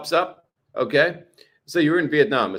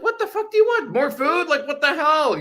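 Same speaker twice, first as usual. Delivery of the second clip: exasperated at cat; 12 semitones higher than usual. Retold or imitated. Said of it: "meow after meow".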